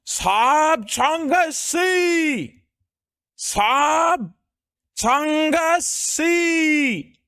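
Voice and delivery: put-on very high, hugely drawn-out Indian-accented cry. Streamer riffing for chat before apologising, mock Indian-accented proclamation. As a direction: stretch every syllable up high, Indian accent.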